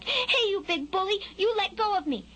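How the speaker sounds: high, protesting fledgling voice; small bird standing up to a bully; indignant little bird. A narrator's reading. Skylark to the worm pulling at him, high and indignant.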